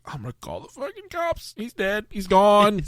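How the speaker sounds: high, hammed-up panicked thought; mock panic. Thinking aloud after the call drops, mock panic. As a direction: convince yourself he's dead. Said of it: "I'm thinking, I'm like".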